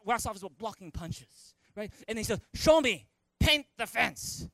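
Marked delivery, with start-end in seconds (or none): 2.5-4.5 loud, higher-pitched, stretched order; mock drill command; Mister Miyagi. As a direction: demand it like a martial arts master.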